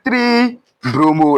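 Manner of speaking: three bromo spelled out slowly, each word stretched; slow, drawn-out dictation. Shown as a demonstration. Teacher to students, slowly dictating the name three bromo. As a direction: stretch out three bromo so the class can follow. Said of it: "We say what".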